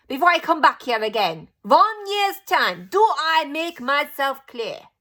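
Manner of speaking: slow, loud, high foreign witch orders; Grand High Witch giving her orders to the witches; commanding witch. A narrator's reading. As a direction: lay down the law, word by word.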